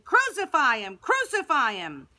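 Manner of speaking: high, loud repeated demand; crowd screaming. A narrator's reading. A thousand people ready to riot, screaming. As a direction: cry it out as a rioting crowd.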